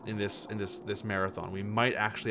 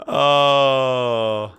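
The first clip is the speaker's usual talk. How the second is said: big belly laugh; 9 dB louder than usual. Real, not acted. Streamer tickled by a game quote, loud helpless laughing fit. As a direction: laugh hard, then let out a long "oh".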